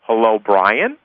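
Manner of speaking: "Brian" rising sharply into a question; questioning greeting. Shown as a demonstration. Coach showing the cold-market opener, questioning greeting. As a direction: lift "Brian" as if asking. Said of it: "You would simply say".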